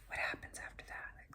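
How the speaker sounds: real whisper while trying to remember; whisper to herself. Real, not acted. Losing the plot of the show, whispering to herself. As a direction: drop to a whisper, thinking aloud. Muttering to herself mid-recap.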